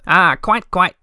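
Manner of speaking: brisk, breezy dismissal; cheerful. A narrator's reading. Little My cutting Moomintroll off, cheerful. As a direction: wave off his story lightly. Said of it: "said Little My cheerfully".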